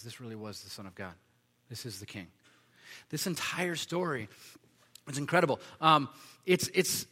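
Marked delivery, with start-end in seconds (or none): 0.0-2.3 much softer and lower, hushed recognition; awed centurion. Retold or imitated. Centurion at the cross recognizing the king, hushed. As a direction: breathe it out in awe.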